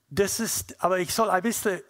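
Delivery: ordinary delivery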